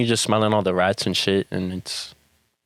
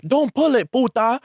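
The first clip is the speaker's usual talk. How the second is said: high-pitched imitated angry warning; mock yelling; 13 semitones higher than usual. Other girls yelling at the lady, mock anger. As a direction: mimic girls scolding in a very high voice. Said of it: "they're yelling at her".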